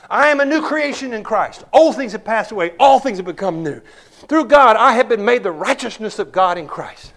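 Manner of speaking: preacher showing the church how to confess scripture aloud; bold declaration. Shown as a demonstration. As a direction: declare it with full conviction. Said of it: "Do you claim your inheritance?".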